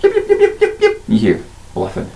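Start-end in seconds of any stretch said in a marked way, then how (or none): 0.0-1.0 high, tiny kobold yips; yipping kobolds. Acted. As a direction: yap like a pack of little kobolds. Kobolds chattering at mind flayer.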